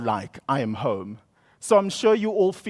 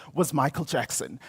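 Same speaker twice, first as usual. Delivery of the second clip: usual speaking voice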